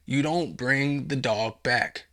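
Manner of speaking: ordinary talk, nothing marked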